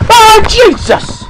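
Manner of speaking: high, loud drawn-out shriek; real jump-scare yell; startled scream. Real, not acted. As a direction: cry out at a sudden fright. Jump scare in horror game.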